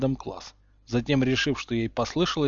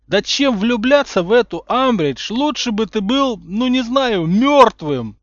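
loud, higher, fed-up yell; exasperated; 10 semitones higher, 9 dB louder than usual. A narrator's reading. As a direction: vent all the frustration. Ron exasperated. (раздражённо кричит)

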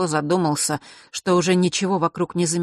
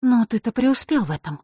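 higher, mildly indignant comeback; indignant retort; 7 semitones higher than usual. A narrator's reading. Vasilisa can't hold back against Mark, mildly indignant. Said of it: "не выдержала Василиса".

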